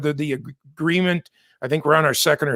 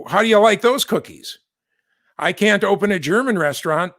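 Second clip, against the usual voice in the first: normal talking voice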